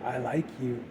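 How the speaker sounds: put-on low, very soft quote of a horror-film girl; imitated movie girl. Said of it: "see, even says there".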